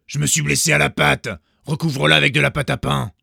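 loud demanding order; wolf. A narrator's reading. Wolf demanding the baker cover his paw, loud.

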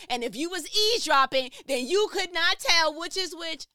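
high, hammed-up family shouting; booming imitation of loud New York relatives. Shown as a demonstration. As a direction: keep yelling so the whole house hears. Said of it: "very loud New York talking family".

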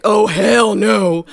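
slow, loud cartoon Fat Albert "Oh, hell no". Her brain answering the date in a loud Fat Albert voice. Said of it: "like, Fat Albert voice is like".

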